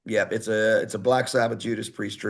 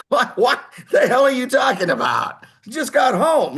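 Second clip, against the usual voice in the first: high, loud incredulous outburst; sudden shocked protest, pitched way up; retold disbelief; 14 semitones higher, 6 dB louder than usual. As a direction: shout it like you can't believe the offer. Incredulous reply to surprise offer.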